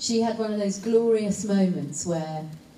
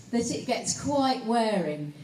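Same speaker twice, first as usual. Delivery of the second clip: normal talking voice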